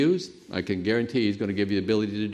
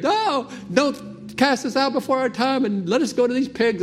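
high, pitched-up pleading demon voice; 12 semitones higher than usual. Retold or imitated. Preacher voicing demons begging Jesus, high pleading. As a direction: beg like frightened demons, high.

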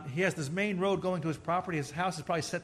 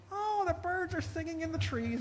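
much higher, prim mock old-lady voice; teasing the ladies leaving mass, in a much higher mock voice; mock church lady; 11 semitones higher than usual. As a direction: lift into a dainty lady falsetto. Mimics church ladies outside.

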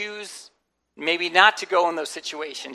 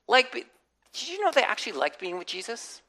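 usual speaking voice

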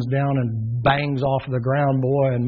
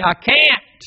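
anguished refusal; 11 semitones higher, 8 dB louder than usual. Acted. Noah answers the crying crowd.

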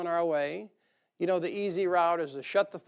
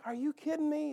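much higher, incredulous squeak; mock disbelief; 8 semitones higher, 6 dB quieter than usual. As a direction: play Paul's shocked protest to the Lord. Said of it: "and Paul's like".

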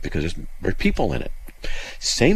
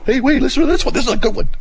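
higher, louder, eager showman; mock excited host; 5 dB louder, faster than usual. Host pretending to present hate mail clips, higher and louder with glee. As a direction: sell it like a gleeful hate-mail reader. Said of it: "clips of me doing hate mail".

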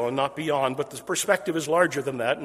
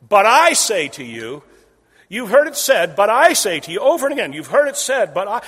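loud, higher, declaiming each you've heard it said; ringing proclamation; 4 semitones higher, 10 dB louder than usual. Acted. Preacher booms Jesus' refrain to the crowd, loud and lifted. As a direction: proclaim it with full authority.

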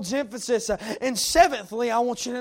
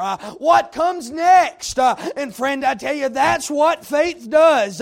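rising, rhythmic pulpit cadence with punched words; preaching at full force; 4 semitones higher, 8 dB louder than usual. Acted. Preacher exhorting the congregation, full-force preaching. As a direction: preach it hard to the room.

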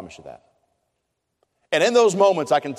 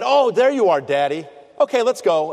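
put-on higher and louder, a small child's sudden relief; relieved little boy; 6 semitones higher, 5 dB louder than usual. Preacher voicing his son finding him in the dark gym, relieved childlike voice. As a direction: pipe up like a four-year-old who just found his dad.

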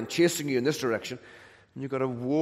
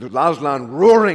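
louder, booming on the lion's roar; roaring preacher; 3 semitones higher, 12 dB louder than usual. Acted. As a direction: let the roar of Aslan burst out.

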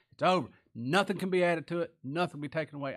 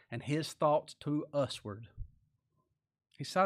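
usual speaking voice

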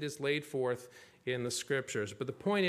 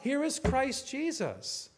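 preacher's gospel call; 6 semitones higher, slower than usual. Retold or imitated. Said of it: "He has this gospel call".

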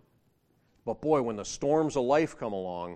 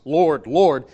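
"Lord, Lord" called out much louder, both hit hard; stress on Lord, Lord; 4 semitones higher, 13 dB louder than usual. Shown as a demonstration. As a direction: punch each "Lord" loud and falling. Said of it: "To use the word Lord twice".

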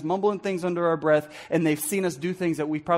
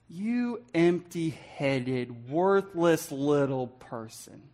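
low, slow, softly spoken put-down; slow, quiet contempt; muttered insult; 5 semitones lower, 7 dB quieter, much slower than usual. Acted. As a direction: drag the insult out quietly, lower in pitch. Acts out the insult Raca.

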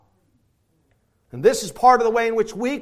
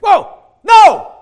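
loud, high exclaimed refusal; high ringing shout; shouted whoa no; 14 semitones higher, 15 dB louder than usual. Retold or imitated. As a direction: cry it out in triumph. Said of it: "he goes".

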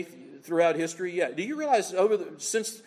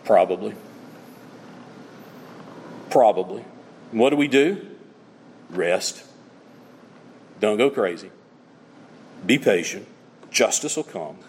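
hushed solemn preaching; 9 semitones lower, 4 dB louder than usual. Acted. Preacher consoling believers, low and grave. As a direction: let each short line sink in.

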